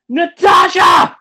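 loud, high, drawn-out call; yell. Retold or imitated. Man calling out to his date in the theater, yelling. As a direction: yell the name across a crowd. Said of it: "And I just yell".

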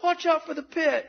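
high-pitched, held-back call; mock warning. Preacher warning people crawling near the orchestra pit, high mock warning.

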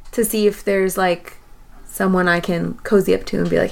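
ordinary delivery

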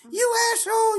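lady at Vons yelling as he leaves; cussing lady. Retold or imitated. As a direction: screech it at him like an enraged shopper.

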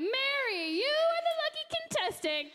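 high, excited game show host voice. Pretend. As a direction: call her up like a prize winner. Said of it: "there's the first announcement".

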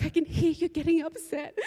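quoting the agent while laughing and near tears; laugh-crying quote. Retold or imitated. Retelling the phone agent's words, laughing through tears.

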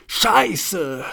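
high, sudden curse at the exploding engine; shouted curse. Acted. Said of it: "rief Bruno". (Bruno flucht erschrocken, hoch und laut)

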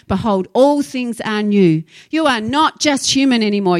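ordinary talk

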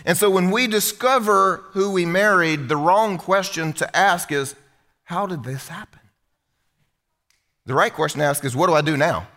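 ordinary delivery

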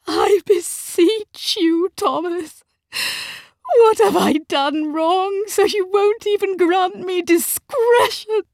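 high, loud, tearful pleading; wailing, desperate wife; crying. A narrator's reading. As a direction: beseech him through tears. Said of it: "Her mother was crying".

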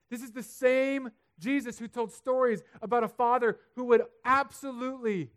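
loud, high preaching; impassioned pulpit build; full-force preaching. Real, not acted. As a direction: preach it with fire.

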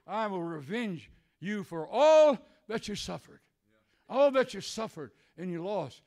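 loud, high proclamation of God's word; booming promise of revenge; preaching. Acted. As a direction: declare it to the church.

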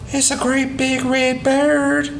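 a hint of an excited child calling up. Doodle calling to the family from the yard, mild boyish call. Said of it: "he called".

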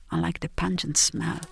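plain reading voice

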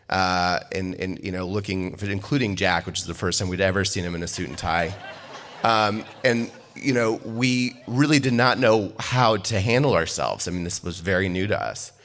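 ordinary talk, nothing marked

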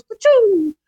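high burst of a toy rocket sound; rocket blast noise. Retold or imitated. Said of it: "then it goes".

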